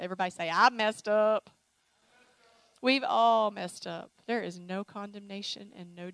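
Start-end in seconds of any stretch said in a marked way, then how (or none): 0.5-1.5 loud, drawn-out declaration; call-out to repeat. Shown as a demonstration. Preacher leading everybody to say it, loud and slow. 2.8-4.1 loud, slow, stretched words; emphatic declaration. Real, not acted. Preacher including the whole congregation, loud and slow.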